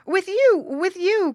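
high, loud pleading cry. Acted. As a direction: cry out desperately like a child. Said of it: "cried the child".

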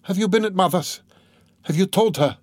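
high, horrified questioning; a sister's high-voiced, horrified cry; horror-stricken. A narrator's reading. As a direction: ask in alarm, pitch jumping up. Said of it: "horror-stricken".

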